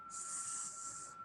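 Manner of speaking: long unvoiced s hiss. Shown as a demonstration.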